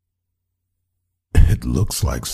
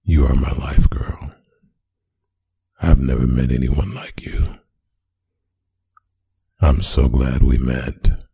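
ASMR whisper. Acted. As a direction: whisper sweet nothings close to the mic. ASMR recording before interruption.

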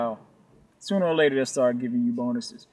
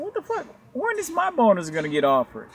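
high-pitched, incredulous inner voice; retold baffled protest, pitched high; mock indignation; 9 semitones higher than usual. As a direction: complain in a pitched-up mock whine. Retells his indignant inner thought.

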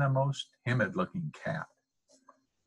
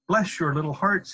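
high, effusive cry of gratitude; 7 semitones higher, 4 dB louder than usual. A narrator's reading. Fox on his knees to the dentists, crying out. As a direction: gush it at a raised pitch. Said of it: "he cried".

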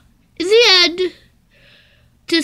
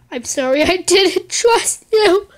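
normal talking voice

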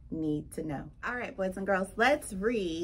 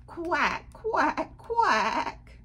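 higher, drawn-out mock-sad quacks; sad quacking; 7 semitones higher, 3 dB louder, much slower than usual. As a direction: quack as if missing a friend. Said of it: "they're sad".

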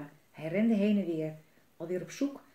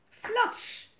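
sudden high, punchy splat word; exclaimed sound effect; 4 dB louder than usual. A narrator's reading. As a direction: burst out with a slapstick splat. Sound word for slipping in mud.

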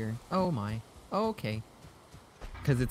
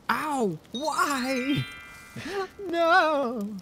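high, drawn-out cry of protest; high hammed-up howl; mock wail; 13 semitones higher, 4 dB louder, much slower than usual. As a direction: wail it in playful despair. Hurt in game, wailing protest.

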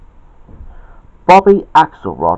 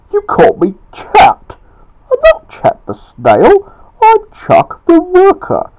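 loud scolding that climbs higher and higher; indignant shout; 8 semitones higher, 5 dB louder than usual. A narrator's reading. Chuck objects to being called Chet, indignant shout. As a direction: build from cross to high-pitched outrage. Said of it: "shouted Chuck".

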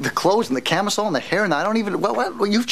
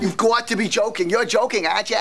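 higher, mocking New York accent; 7 semitones higher than usual. [name] mocking Carol's new New York accent, higher and mocking. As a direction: parody her New York accent back at her. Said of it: "That's not even a New York accent".

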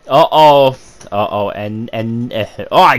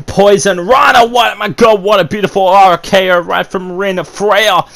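excited shouting call; 5 semitones higher than usual. Real, not acted. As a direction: yell the move names in disbelief. Commentator shouting big move.

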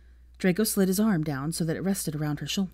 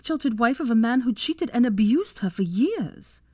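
higher-pitched woman pouring out hurt and anger; hurt wronged wife; 5 semitones higher than usual. Acted. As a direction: let long-held hurt spill out, pitched up. Voices Hermione pouring out hurt.

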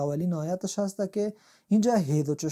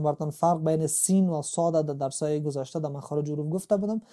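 normal talking voice

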